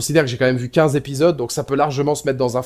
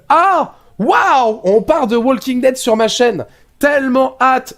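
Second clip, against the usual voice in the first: mock excitement; 10 semitones higher, 3 dB louder, slower than usual. Fake hype about hated show.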